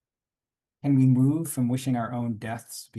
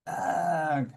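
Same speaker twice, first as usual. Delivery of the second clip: pained groan; 7 semitones higher than usual. Real, not acted. Grief breaking through the reading.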